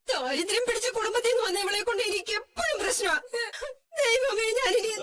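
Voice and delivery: wailing sob. Acted. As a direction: sob and wail to God. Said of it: "കരയാതമ്മേ". (ഉറക്കെ കരഞ്ഞ് വിലപിക്കുന്നു)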